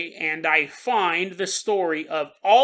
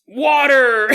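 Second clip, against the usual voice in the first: loud shouted call of water; 4 semitones higher, 8 dB louder than usual. Pretend. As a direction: shout it out for the crowd to repeat. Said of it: "Say it with me now".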